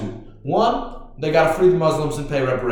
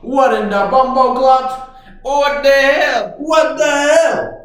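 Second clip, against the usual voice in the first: put-on loud, high Jamaican-accented shouting; mock Jamaican outrage; 9 semitones higher, 7 dB louder, slower than usual. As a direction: yell it in Jamaican patois, higher and louder.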